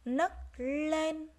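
"Nấc lên" loud and high, held N, held L; careful N and L. Shown as a demonstration. Teacher modelling "Nấc lên" for pupils, distinct N then L. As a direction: separate "Nấc" and "lên", leaning on each first consonant. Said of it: "Nghe cô đọc lại nhé".

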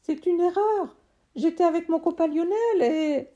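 put-on a kid pleading to be heard; boy's voice.

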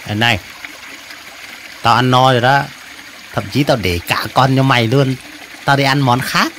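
low-pitched, casual creature voice; bow out in a low cartoon voice; sated mantis. Mantis leaving the prey to the other, low and easy.

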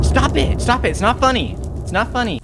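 high, loud frightened protest; scared yelling. Real, not acted. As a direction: yell at him to stop.

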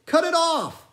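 high, loud, excited sailor calling out; frantic old sailor. A narrator's reading. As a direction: shout over the sea, searching for Pip. Narrator voices Manxman's frantic shouts.

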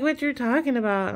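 a lightly voiced puzzled mother; quoting mom. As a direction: raise pitch for the confused mom. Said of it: "She's like".

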